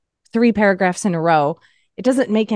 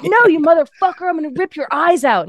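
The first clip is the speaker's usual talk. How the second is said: higher-pitched mock-furious threat; angrier caller; 8 semitones higher than usual. Caller made madder by the chatbot's gift card offer, mock-furious. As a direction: escalate into an over-the-top customer rant. Acted caller, now more mad.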